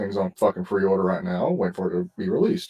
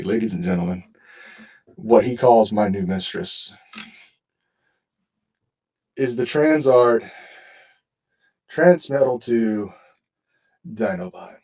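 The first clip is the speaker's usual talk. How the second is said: grand reveal. Real, not acted. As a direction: present it like a prize. Grand announcement to listeners.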